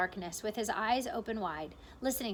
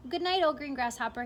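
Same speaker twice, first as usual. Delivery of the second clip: usual speaking voice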